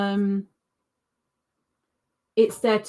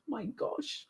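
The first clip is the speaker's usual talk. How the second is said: very soft, near-whispered disbelief; barely audible exclamation; hushed aside; 3 semitones higher, 16 dB quieter than usual. Real, not acted. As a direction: drop to a murmur to yourself. Mutters after the outburst.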